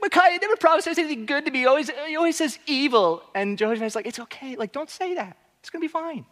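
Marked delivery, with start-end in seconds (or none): 0.0-3.2 put-on high, fast whiny baby king. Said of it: "he kind of just seems like a whiny baby here". 4.1-6.3 put-on much softer, higher, soothing a fussy kid; calming a child. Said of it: "calming down his child".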